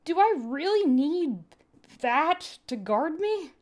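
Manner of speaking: uneasy, slow plea; Terra's voice. Acted. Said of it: "Terra asked".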